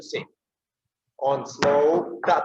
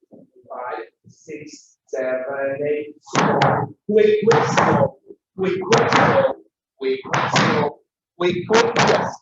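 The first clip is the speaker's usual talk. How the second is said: loud high dance calls; called count; 6 semitones higher, 4 dB louder, slower than usual. Shown as a demonstration. Teacher calling counts over the music. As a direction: shout the count with urgency. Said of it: "present the rhythmical pattern".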